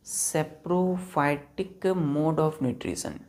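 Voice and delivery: very slow, word by word for note taking; dictating slowly. Shown as a demonstration. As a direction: spell it out slowly so the class can write. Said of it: "किसके?".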